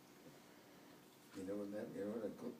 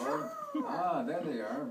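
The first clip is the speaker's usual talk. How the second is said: ordinary delivery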